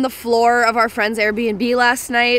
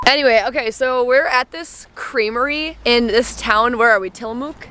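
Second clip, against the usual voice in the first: ordinary delivery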